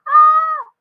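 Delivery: high, held exclamation; sudden high-pitched held note; high sung-out "ah". Real, not acted. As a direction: let out a high, sustained ah. Mock exclamation at her own revelation.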